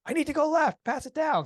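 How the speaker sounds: high, loud shout out the window; yelling over. Retold or imitated. Driver yells to other cars to get left, loud shout. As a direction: shout it across lanes of traffic, retold. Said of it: "yell over".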